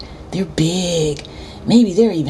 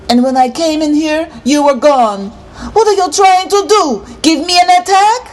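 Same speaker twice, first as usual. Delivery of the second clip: loud, high, rising outrage; mad scolding; 10 semitones higher, 5 dB louder than usual. A narrator's reading. Neighbour tells off the boy for vanishing, mad. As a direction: build to a high, exasperated demand. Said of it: "all mad".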